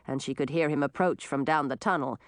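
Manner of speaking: plain reading voice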